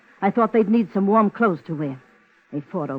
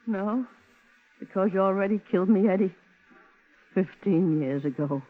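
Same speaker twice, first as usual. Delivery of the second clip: quiet grief; 2 semitones higher, 4 dB quieter than usual. Acted. Woman recalls her ruined life.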